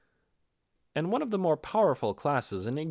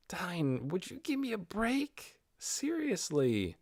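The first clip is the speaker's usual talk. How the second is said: much higher, softer, whining plea; mortified protest; 7 semitones higher, 5 dB quieter, slower than usual. Acted. Ninya begging Dine to stop teasing, high mortified whine. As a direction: squirm with embarrassment, high and quiet. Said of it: "writhing from the awkwardness of it all".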